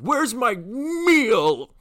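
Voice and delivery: high, loud, very slow whiny demand; hammed-up demand. Teen retelling his rude drive-thru demand, hammed-up whine. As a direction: stretch every word like a brat. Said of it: "I said".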